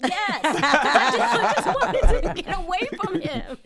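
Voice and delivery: laughing loudly through the words. Real, not acted. Laughing while telling the hosts about [name]. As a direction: laugh out the answer.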